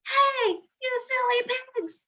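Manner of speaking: high playful yell; mock yell chasing pigs away. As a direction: shout at the silly pigs. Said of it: "and yelled".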